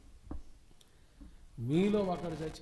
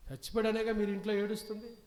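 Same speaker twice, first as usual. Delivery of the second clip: challenging; 6 semitones higher, 4 dB louder, faster than usual. Acted. Professor challenging the family.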